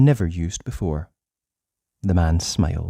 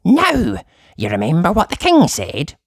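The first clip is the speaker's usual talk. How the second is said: high, louder squeaky warning; goblin warning; 7 semitones higher, 4 dB louder than usual. Acted. Goblin warning Uppity, high and squeaky. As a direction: wag a finger as a little goblin. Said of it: "warned the goblin".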